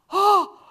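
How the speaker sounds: high, open gasp of shock. Retold or imitated. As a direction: gasp as if suddenly struck. Acts out shocked reaction.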